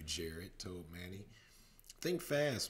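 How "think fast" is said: higher, louder call. Acted. Manny calling out before throwing snow. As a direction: shout it as you throw. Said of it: "Manny cried".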